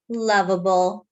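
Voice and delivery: ordinary delivery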